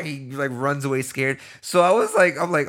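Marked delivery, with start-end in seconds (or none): none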